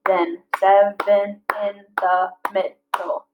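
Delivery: slow, each syllable spaced out; slow syllable-counting read. Shown as a demonstration. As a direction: tap out each beat. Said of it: "seven syllables".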